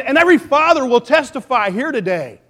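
normal talking voice

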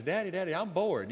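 small son nagging his napping father, lightly voiced whine; bored little boy. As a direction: whine it like a bored kid tugging at dad. Preacher voices small boy in story.

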